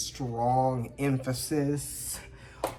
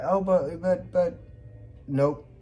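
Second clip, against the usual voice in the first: mock flustered refusal; 6 semitones higher, 4 dB louder, slower than usual. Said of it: "And then Virgo is like".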